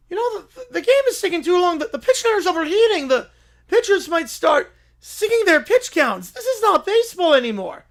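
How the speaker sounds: announcer losing it over the chaos; frantic commentary. Acted. Panicked announcer as game spirals.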